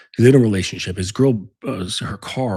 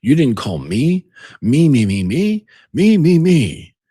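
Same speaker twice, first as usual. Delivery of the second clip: rising, louder whiny me me me; mock ego rant; 7 semitones higher, 8 dB louder, slower than usual. Jealous boyfriend ranting at his girl, hammed-up ego whine. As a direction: ham up a wounded ego building higher. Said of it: "All ego".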